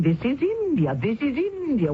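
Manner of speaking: mocking repetition. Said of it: "Why does everyone keep saying".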